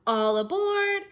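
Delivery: high, loud train conductor shout; all aboard shouted high and loud; conductor's call. Acted. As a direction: call everyone onto the train.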